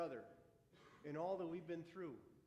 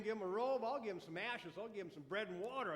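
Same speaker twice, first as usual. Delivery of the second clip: ordinary talk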